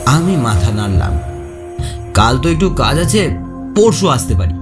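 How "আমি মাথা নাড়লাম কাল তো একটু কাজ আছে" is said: plain reading voice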